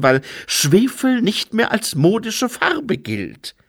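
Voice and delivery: high, loud, whining complaint; self-pitying angel in full flow; aggrieved angel, lamenting. Acted. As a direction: lament your lost big moment in a high voice.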